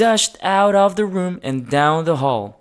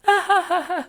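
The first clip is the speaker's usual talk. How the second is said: laughter. A narrator's reading. Everyone laughing.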